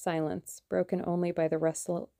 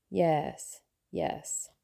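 breathy little laugh under the words, half to himself; soft amused chuckle. Acted. Russian conspirator chuckling softly at the German's point, amused laugh. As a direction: let the yeses dissolve into a quiet chuckle. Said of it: "The Russian laughed softly, as though amused".

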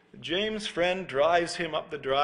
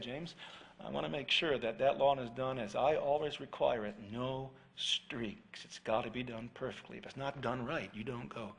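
low, much softer, measured warning; stern quiet dad; 4 semitones lower, 9 dB quieter than usual. Retold or imitated. Dad warning son before the mowing, low and soft. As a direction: lay down the rule quietly and firmly.

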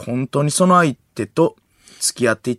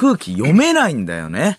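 sing-song teller of urban legends; 関明夫 impression; 2 semitones higher, 3 dB louder than usual. Needling the radio guest about secrets, theatrical impression. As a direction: swoop the pitch up on the knowing tag question. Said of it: "いや、関明夫".

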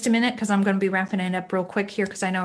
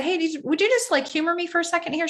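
pitched up high, asking a favour nicely; higher, sugary mom asking a small favour; sweet coaxing request; 9 semitones higher than usual. Retold or imitated. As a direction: coax your teen into humoring you. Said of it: "And I said".

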